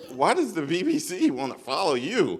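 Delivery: loud, high voice of inner doubt; little Stevie. Retold or imitated. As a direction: challenge yourself as the incredulous imposter on your shoulder. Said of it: "the imposter on my shoulder, little Stevie".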